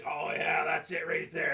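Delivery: loud pleased sigh of relief; relieved and loud; loud relieved moan. Retold or imitated. Wife enjoying back scratch.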